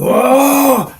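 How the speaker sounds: high, loud exclamation; excited whoa. Retold or imitated. Bouncer reliving a bar fight, excited whoa. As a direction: burst out as the action starts.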